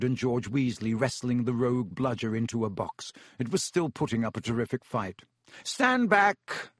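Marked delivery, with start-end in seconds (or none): 5.6-6.7 high commanding call. A narrator's reading. Lockhart commanding.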